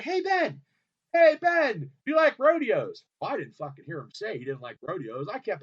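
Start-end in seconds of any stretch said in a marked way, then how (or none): 0.0-3.0 pushy, high, loud call. Retold or imitated. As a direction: badger a star from the crowd. Calling out to Ben repeatedly.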